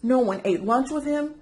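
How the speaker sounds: ordinary delivery, nothing marked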